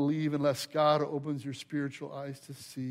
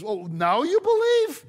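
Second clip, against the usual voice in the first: much higher, loud mock disbelief; incredulous; 11 semitones higher, 9 dB louder than usual. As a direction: throw up your hands, amazed at them. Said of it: "Jesus is a little incredulous".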